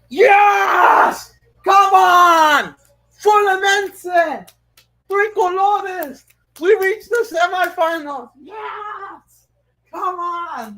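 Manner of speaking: high, loud victory yells; yelling from the sidelines. Real, not acted. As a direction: roar it out like a fan whose team just won. Team wins, fan yelling celebration.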